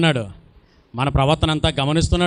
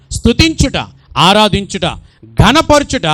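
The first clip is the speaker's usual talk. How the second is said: louder and higher with each word; rising preaching; 5 semitones higher, 6 dB louder than usual. Acted. Preacher stirring the church to praise, climbing louder. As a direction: build the list to a cry.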